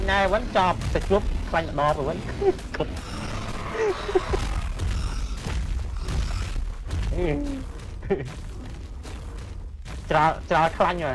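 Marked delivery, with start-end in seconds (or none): 2.2-7.1 high airy laughing fit; cracking up at teammates. Real, not acted. 7.4-10.0 quiet airy laugh dying away; soft laugh. Real, not acted. Laughing fit.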